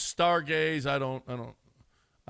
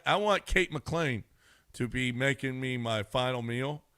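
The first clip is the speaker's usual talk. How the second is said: normal talking voice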